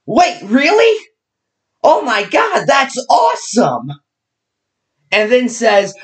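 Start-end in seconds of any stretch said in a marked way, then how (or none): 0.0-3.9 high-pitched, drawn-out delighted disbelief; mock amazement. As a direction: imitate someone thrilled by a terrible idea. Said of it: "looks at Ben Franklin's quote and says".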